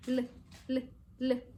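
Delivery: short "l" sounds, not the letter name; isolated letter sound. Shown as a demonstration. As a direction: say "l" alone, briefly and evenly spaced. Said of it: "los sonidos".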